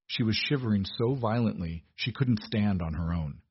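usual speaking voice

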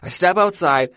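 ordinary talk, nothing marked

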